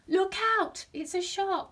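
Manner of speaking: loud, high warning cry; terrible cry. A narrator's reading. Small fish scaring dozing Octopus, loud cry. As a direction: cry out a high, urgent alarm. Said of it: "came the terrible cry".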